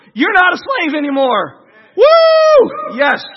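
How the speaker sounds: loud, high celebratory whoop; exultant, full-voiced cheer; jubilant cheering. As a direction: celebrate like someone just set free. Voicing the freed people celebrating.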